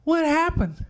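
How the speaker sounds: put-on high, anxious child's voice, rising to the question; a frightened little girl begging to know; child asking. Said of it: "I said".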